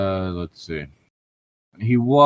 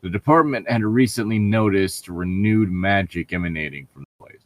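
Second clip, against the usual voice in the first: ordinary delivery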